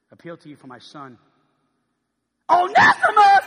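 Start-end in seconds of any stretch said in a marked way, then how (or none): 2.5-3.5 high, loud outburst of the name; shocked shout. Acted. Philemon shouting the runaway's name in shock. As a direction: blurt it out in shock. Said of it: "let's act this thing out".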